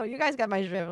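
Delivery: ordinary talk, nothing marked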